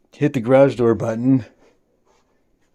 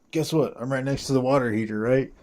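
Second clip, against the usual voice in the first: normal talking voice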